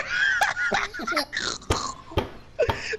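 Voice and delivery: squealing laugh; high laugh. Real, not acted. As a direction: crack up hard. Laughs at the story.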